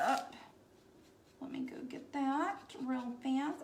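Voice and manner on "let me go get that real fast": whisper. Real, not acted. Painter leaning in close to the canvas, whispering. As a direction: whisper it, concentrating on the detail.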